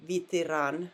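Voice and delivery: Vitiran with the first e said as ee; Vitiran, vee-tee-RAN, stress at the end; Russian vowel demo. Shown as a demonstration. Teacher shows students the reduced vowel, careful. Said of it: "pronounced like E".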